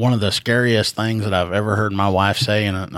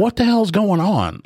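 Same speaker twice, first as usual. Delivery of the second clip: alarmed high quote; 10 semitones higher than usual. Retold or imitated. Retelling alarm at his wife.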